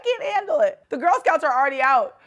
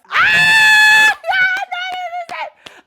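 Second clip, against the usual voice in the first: very high, loud squeal breaking into laughter; excited scream; 8 dB louder, much slower than usual. Real, not acted. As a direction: shriek with delight, then laugh through the words. Screams on recognising [name].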